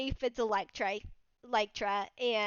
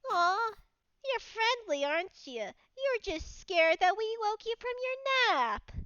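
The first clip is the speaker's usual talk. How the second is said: higher, tender cooing; cooing to beetle; 6 semitones higher than usual. Acted. Mad Lad cooing tenderly at the nuzzling beetle. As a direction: coo at it like a pet.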